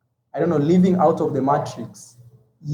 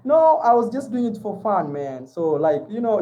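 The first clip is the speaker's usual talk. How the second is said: usual speaking voice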